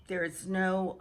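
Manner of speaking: ordinary delivery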